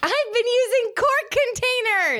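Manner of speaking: very high, drawn-out declaration; exclaimed echo. Pretend. Co-host owns up to her quart containers, high drawn-out exclamation. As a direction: sing out the words slowly, way up high. Exclaims, echoing the host's statement.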